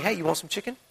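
low, soft, offhand offer; acting an unbothered God, casually quiet; relaxed. God to his stressed people amid battle, relaxed. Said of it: "that's how relaxed he is".

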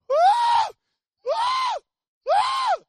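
piercing high whoop, very loud; panicked whoops. Retold or imitated. Preacher acting out his terrified climb, piercing panicked whoop. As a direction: shriek "woo" in comic terror.

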